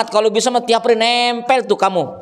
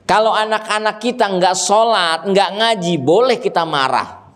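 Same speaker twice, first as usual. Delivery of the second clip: normal talking voice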